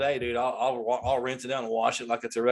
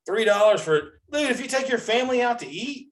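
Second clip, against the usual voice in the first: can you believe these prices; exasperated rant; 10 semitones higher than usual. Real, not acted. Complaining to listeners about soda prices, incredulous. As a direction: pitch jumps high, incredulous.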